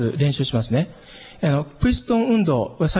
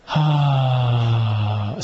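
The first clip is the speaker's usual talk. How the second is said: long, even "haa" on one steady low note; relaxed held tone; 5 semitones lower than usual. Shown as a demonstration. Teacher showing diaphragm breath to students, relaxed. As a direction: let the voice ride the out-breath. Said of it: "音は、高さはいいんですね".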